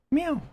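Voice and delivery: high meow to the cat; imitated meow. Shown as a demonstration. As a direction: meow at her to get one back. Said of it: "Go meow".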